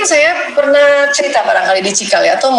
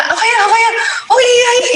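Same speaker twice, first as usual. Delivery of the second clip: much higher, eager and cheerful; delighted response; 12 semitones higher than usual. Retold or imitated. Wife reacting happily to her husband's funny story, excited. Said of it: "saya langsung gembira aja gitu".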